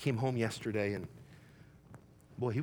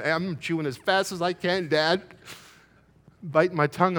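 hurried retelling; 6 semitones higher, 8 dB louder than usual. Retold or imitated. Son's frantic chewing retold.

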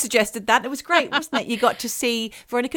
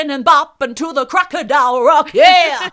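loud, high rock-star shout; 5 semitones higher, 10 dB louder than usual. Retold or imitated. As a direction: belt it like a camp rock star. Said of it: "That version".

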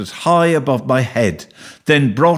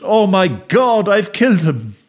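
moaning; 7 semitones higher than usual. A narrator's reading. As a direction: moan it out, appalled. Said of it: "I moaned".

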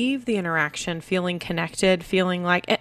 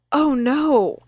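higher-pitched, lightly acted dismay; imitated concern; 7 semitones higher, 4 dB louder than usual. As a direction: voice a child's sudden concern. Voices child's genuine concern after hurting.

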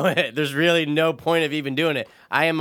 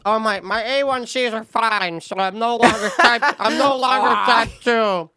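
mock fat lady; 7 semitones higher, slower than usual. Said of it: "This fat lady today goes".

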